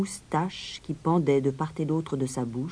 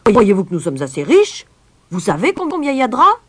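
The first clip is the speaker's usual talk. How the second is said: loud indignant outburst, climbing higher; roaring mayor; 7 semitones higher, 12 dB louder than usual. A narrator's reading. Mayor protests the cost to the stranger, roaring. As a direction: keep roaring, rising to a high pitch. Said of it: "puis il rugit".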